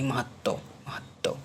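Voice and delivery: महत्व slowly, with a doubled t; corrected pronunciation. Shown as a demonstration. Teacher has students read aloud, slow careful महत्व. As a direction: split महत्व as mahat-tva, unhurried. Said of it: "बोल बोल के उसको पढ़िए".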